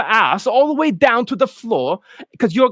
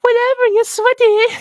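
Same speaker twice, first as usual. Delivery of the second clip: little boxer; 3 dB louder than usual. Acted. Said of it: "They're like".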